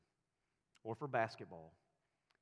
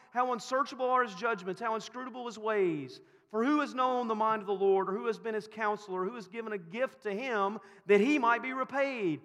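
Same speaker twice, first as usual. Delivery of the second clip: high, loud recited doxology; preaching at full force; 8 semitones higher, 8 dB louder than usual. Acted. Pastor reciting Paul's praise to the congregation, loud and high. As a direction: proclaim it with awe.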